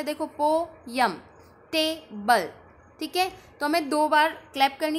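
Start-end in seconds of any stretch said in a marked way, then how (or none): 0.3-1.1 poem broken into two slow beats; split into syllables. Shown as a demonstration. Teacher showing how to count syllables, poem split in two. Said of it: "कैसे पता चला टू syllable है?". 1.7-2.5 table broken into two separate beats; split into syllables. Shown as a demonstration. Teacher showing how to count syllables, table split in two. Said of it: "कैसे पता चला टू syllable है?".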